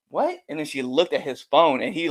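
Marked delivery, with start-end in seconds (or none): 0.1-0.4 sharp gasp and high "What?"; shocked reaction. Retold or imitated. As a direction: imitate a stunned woman, voice shooting up. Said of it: "the genuine reaction".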